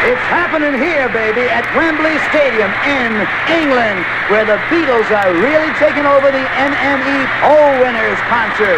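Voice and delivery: high-pitched, excited event announcement; hyped radio announcer. Acted. Radio host announcing the Beatles at Wembley, hyped announcer. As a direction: sell it like a thrilled DJ on location. Hyped reports from stadium and set.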